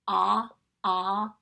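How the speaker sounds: Ah, ah as two loud short ahs like octopus; short o sounds. Shown as a demonstration. Teacher leading the vowel chant, loud short o. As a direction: call the short o twice. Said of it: "as I say the sound".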